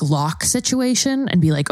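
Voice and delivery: ordinary delivery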